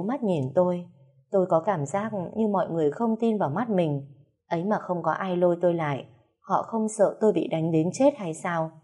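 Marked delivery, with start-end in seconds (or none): none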